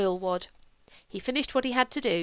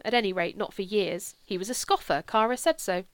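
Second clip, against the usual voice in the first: usual speaking voice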